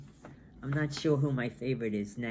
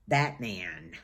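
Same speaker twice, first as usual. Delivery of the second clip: dark side voice; 6 semitones lower than usual. Pretend. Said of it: "The dark side on me comes out".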